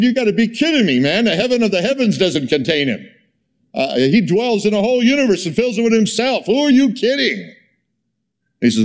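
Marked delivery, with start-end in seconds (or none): 0.0-7.5 high, laughing disbelief; incredulous outburst. Retold or imitated. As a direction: retell the outburst, voice shooting up high. Retelling his outburst at the bishop.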